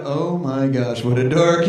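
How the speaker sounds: low, slow mocking drawl; scornful kids. Schoolkids judging the eager boy, low and scornful. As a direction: drag it out with heavy disdain. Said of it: "Kids are looking up and going".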